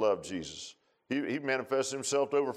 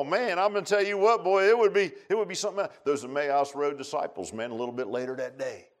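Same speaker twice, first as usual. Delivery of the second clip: normal talking voice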